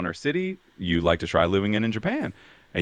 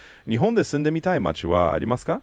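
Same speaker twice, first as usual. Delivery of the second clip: fast native Japanese question; 5 semitones higher, faster than usual. Acted. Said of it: "I'll just repeat it exactly in Japanese".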